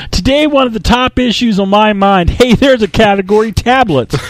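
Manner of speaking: loud, high-pitched bellowing CEO; hammed-up executive shouting to a hall; Steve Ballmer. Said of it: "absolutely fantastic Steve Ballmer impression".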